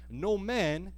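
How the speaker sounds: usual speaking voice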